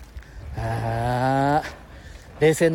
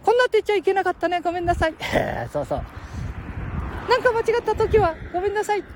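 put-on high squeaky boy voice; cheeky little kid, much higher; Ken-chan voice.

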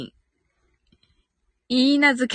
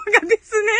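laughing; 7 semitones higher, much faster than usual. Real, not acted. Laughing at a viewer comment.

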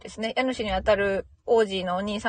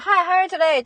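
cheery greeting; 9 semitones higher, 5 dB louder than usual. Acted.

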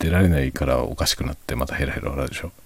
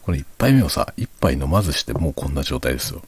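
ordinary delivery

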